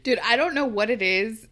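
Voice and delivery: ordinary delivery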